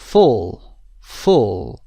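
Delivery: full with a short oo, not the long oo of fool; teacher modelling full with a short vowel. Shown as a demonstration. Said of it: "صدای او کوتاه".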